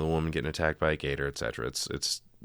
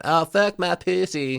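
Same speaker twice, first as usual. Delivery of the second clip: high, loud mock hillbilly twang; hammed-up hillbilly voice, pitched way up; hillbilly accent; 11 semitones higher, 5 dB louder than usual. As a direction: squeal it in a cartoonish hillbilly accent. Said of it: "in her hillbilly accent".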